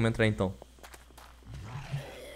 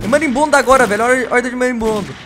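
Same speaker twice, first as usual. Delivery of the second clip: loud excited shout; 9 semitones higher, 8 dB louder than usual. Real, not acted. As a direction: yell out the new threat. Wasps attacking now.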